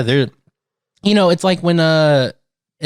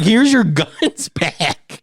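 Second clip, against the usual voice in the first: slow mock surrender; 3 semitones higher, much slower than usual. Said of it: "I'd be like".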